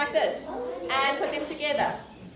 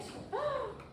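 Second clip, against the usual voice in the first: mock gasp; 10 semitones higher, 8 dB quieter than usual. Acted shock at being late.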